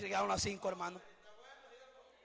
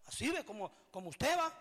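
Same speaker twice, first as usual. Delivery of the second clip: ordinary talk, nothing marked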